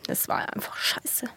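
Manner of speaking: quiet, slow, bitter near-whisper; muted bitter. Real, not acted. Mother closing her Covid birth story, quietly bitter. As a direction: let it drop out quietly.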